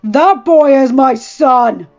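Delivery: put-on slow, loud shout leaning on boy. A narrator's reading. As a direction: roar it as the truest thing he ever said. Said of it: "Ned shouted".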